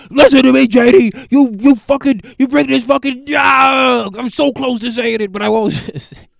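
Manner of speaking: hammed-up furious father barely holding back a slur; angry dad. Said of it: "He's like".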